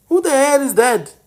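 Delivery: ordinary talk, nothing marked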